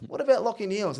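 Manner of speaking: high mock interrogation; comic coach impression; imitating the coach. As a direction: probe the player in a high coach voice. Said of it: "He goes".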